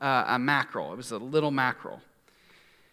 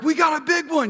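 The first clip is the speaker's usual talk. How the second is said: high, louder excited mock yell; imitated yelling; 12 semitones higher, 5 dB louder than usual. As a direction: imitate a thrilled shout on a boat. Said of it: "he's yelling".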